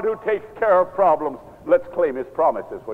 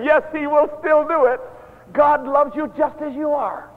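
higher, bright smiling "yes"; smiling reassurance; 9 semitones higher than usual. Retold or imitated. Preacher answering schoolchildren about forgiveness, smiling and reassuring. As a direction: reassure the little ones with a smile. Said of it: "then I smile and say".

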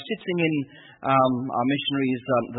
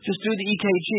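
higher strained breathless voice; 8 semitones higher, faster than usual. Retold or imitated. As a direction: push the words out short of air. Breathless retelling.

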